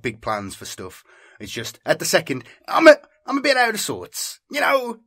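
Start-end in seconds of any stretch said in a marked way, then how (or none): 2.7-5.0 jittery high. Real, not acted. Quitting smoker telling viewers he's off, high and jittery. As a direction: voice jumps much higher and louder.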